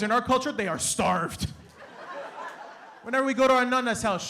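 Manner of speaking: ordinary talk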